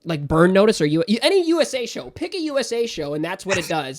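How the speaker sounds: loud, high, heated rant; impassioned rant. Real, not acted. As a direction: vent with real frustration. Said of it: "I'm very impassioned about how mad that makes me".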